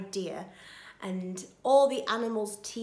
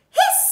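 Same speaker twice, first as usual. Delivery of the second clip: sharp, loud hiss; goose hiss; 12 dB louder than usual. A narrator's reading. Goose hears of the plot, loud hissing. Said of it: "said the goose".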